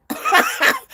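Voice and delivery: short squealing laugh; very high burst of laughter. Real, not acted. Teasing someone who can't take spice, high laugh.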